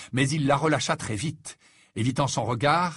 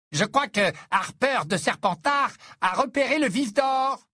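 commentateur au mégaphone; 8 semitones higher than usual. A narrator's reading. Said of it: "dans son mégaphone".

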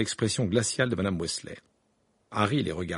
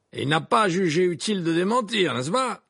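higher, louder jibe meant to be overheard; pointedly loud; 8 semitones higher, 5 dB louder than usual. A narrator's reading. Amos Diggory jabbing at Harry, loud and pointed. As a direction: say it loud enough for Harry to hear. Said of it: "suffisamment fort pour que Harry l'entende".

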